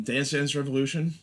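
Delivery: normal talking voice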